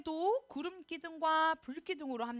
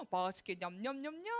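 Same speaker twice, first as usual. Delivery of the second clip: whispered munching; 5 semitones lower than usual. Acted. Eating sound effect.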